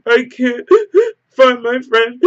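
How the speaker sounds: high, loud sobbing gasps, hammed-up; imitated hysterical crying. Drunk woman crying to a stranger about her lost friend, hammed-up sobbing. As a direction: act out bawling, slow words broken by gasps. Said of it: "like, going off crying".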